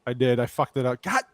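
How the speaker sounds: ordinary delivery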